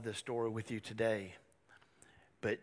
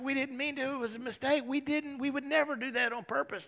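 high, hurried mock pleading; panicked protest; 13 semitones higher, faster than usual. Brothers deny stealing to the Egyptian ruler, pleading in mock panic. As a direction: protest innocence in a high, frantic voice.

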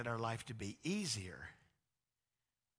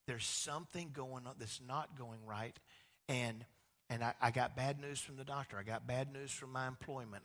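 normal talking voice

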